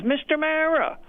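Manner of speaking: very high-pitched imitated shriek, not full volume; high mock fan scream. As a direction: squeal it like a wife spotting her idol. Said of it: "screams at the top of her lungs".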